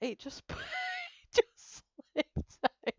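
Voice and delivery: squeaky high laughing protest. Retold or imitated. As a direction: giggle out the excuse, slowly. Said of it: "I said".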